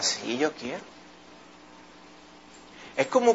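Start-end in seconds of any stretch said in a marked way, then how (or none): 0.0-0.8 much softer, lower Lord's answer; gentle answer. Acted. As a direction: answer the leper softly and tenderly. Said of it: "el Señor le contesta".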